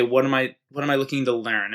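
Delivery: ordinary talk, nothing marked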